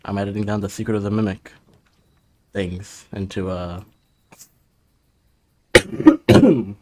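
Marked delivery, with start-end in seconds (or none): 5.8-6.8 coughing. Real, not acted. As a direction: cough mid-word. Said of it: "Sorry for the coughing".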